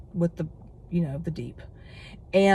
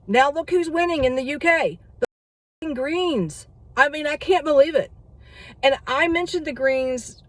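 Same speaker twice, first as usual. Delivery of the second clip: worked up; 7 semitones higher than usual. Real, not acted. Vlogger to viewers about the UK results, outraged disbelief. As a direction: pitch jumps higher in outraged disbelief.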